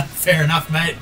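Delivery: ordinary delivery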